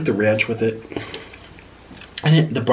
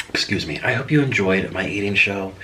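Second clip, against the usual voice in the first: ordinary talk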